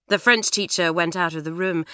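ordinary delivery

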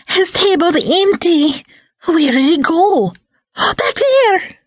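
very high, breathless fangirl voice, gasping on the find; shrieking fangirls. Acted. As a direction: squeal it like a frantic fan spotting her idol. Fangirls chasing Max.

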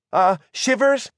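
high-pitched, questioning call of his name; hesitant warning. A narrator's reading. Margot tries to warn Shivers, hesitant warning. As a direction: raise it tentatively, as a question.

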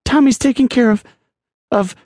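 high-pitched, choked-up woman's line. A narrator's reading. Helen answering a desperate Charlie, choked and high. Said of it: "Helen choked".

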